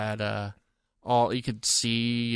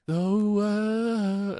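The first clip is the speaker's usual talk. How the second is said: high, slow hobbit singing; Pippin impression; 8 semitones higher than usual. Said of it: "I sound just like him".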